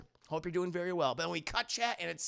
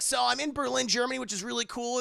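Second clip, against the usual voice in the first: put-on higher, louder kid vlogger; chirpy teen voiceover; 6 semitones higher, 4 dB louder than usual. Imitating Peter's voiceover in Berlin, high and eager. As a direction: narrate like an eager boy filming.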